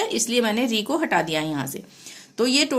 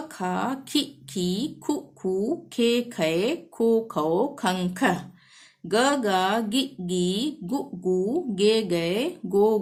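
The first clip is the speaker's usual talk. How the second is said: barakhadi recitation; 2 semitones lower, much slower than usual. Acted. Teacher reciting the syllable chart for students to repeat. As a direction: recite each pair evenly like a drill.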